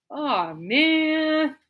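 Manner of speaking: comically bummed-out, long vowels; mock let-down. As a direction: stretch it out in mock dismay. Retells own let-down reaction.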